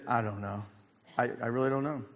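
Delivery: low, quiet, acted uncertainty; subdued reply. Traumatized man answers his boss.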